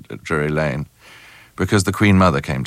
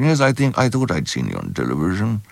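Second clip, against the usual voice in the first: Prince Charles. Acted. Prince confirming he knows the actor's face, mimicked royal voice. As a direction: mimic a vaguely satisfied royal. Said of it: "Prince Charles said".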